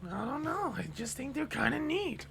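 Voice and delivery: high, hammed-up Marge; bad Marge impression; Marge Simpson impression. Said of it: "I cannot do a proper Marge voice".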